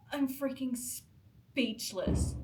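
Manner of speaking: ordinary delivery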